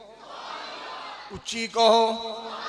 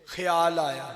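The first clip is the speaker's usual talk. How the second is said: ordinary talk, nothing marked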